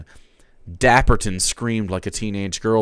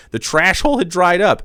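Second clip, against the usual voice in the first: usual speaking voice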